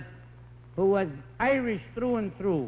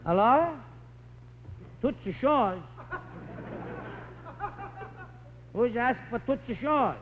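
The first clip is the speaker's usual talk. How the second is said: put-on Italian accent; 5 semitones higher than usual. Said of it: "it sounds like this".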